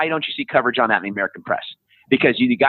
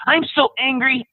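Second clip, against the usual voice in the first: high, cutesy mock-angry voice; hammed-up little tantrum; mock angry youngster; 10 semitones higher than usual. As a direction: play a tiny person pretending fury. Mimics the adorable woke youngsters.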